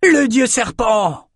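high, slow answering shout; bawling echo. A narrator's reading. Norokai crew echoing the cry, high slow shout. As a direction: another voice takes up the cry. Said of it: "braillèrent les Norokai".

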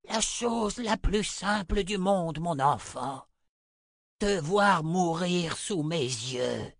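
slow, high, cruel crone; menacing old woman. Acted. Old mother to her child, slow menace. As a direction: savour the threat, drawing it out.

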